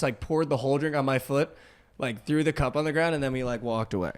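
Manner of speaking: normal talking voice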